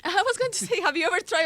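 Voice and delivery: high, laughing through words. Real, not acted. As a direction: laugh while you talk. Laughs at Cuban accent.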